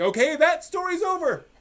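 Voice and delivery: high, bright mock announcement; a jaunty mock proclamation; mock studio voice. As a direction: chirp it like the studio cheerfully closing the book.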